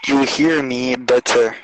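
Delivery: glitchy robotic connection; breaking up like a robot; robotic phone voice. Real, not acted. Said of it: "You sound like a robot".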